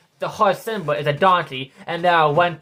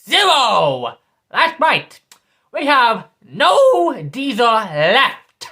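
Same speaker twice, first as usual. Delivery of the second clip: louder and higher, shouting; angry shout; 6 semitones higher, 8 dB louder, much slower than usual. Real, not acted. Furious about the diesel running out, shouting. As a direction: yell it, the last line slow.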